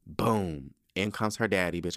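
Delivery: ordinary talk, nothing marked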